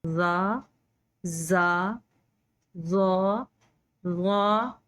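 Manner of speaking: ज़ा said full and thick, tongue to the side; emphatic ज़ा from the side of the tongue; Arabic zad; heavy zad. Shown as a demonstration. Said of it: "इस तरह से ज़ाद की आवाज़ निकलती है".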